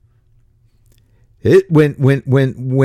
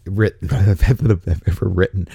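normal talking voice

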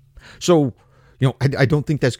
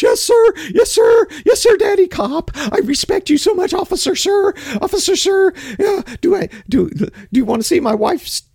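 fawning bootlicker. Retold or imitated. Host parodying driver sucking up to a cop, fawning. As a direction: squeal over the officer like an overeager suck-up. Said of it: "I'm not gonna do this".

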